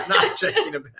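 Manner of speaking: squealing laugh, pitch soaring. Real, not acted. Laughing about bags.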